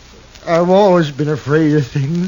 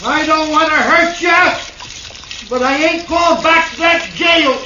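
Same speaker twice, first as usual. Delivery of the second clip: frightened shouting; 11 semitones higher than usual. Acted. Cornered man yells from house.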